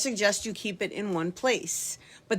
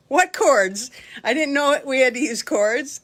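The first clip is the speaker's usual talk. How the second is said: loud, higher-pitched retold bafflement; mock clueless surprise; 6 semitones higher, 7 dB louder than usual. Teacher answering the helper's question about cords, mock clueless surprise. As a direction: play up being caught clueless, loudly.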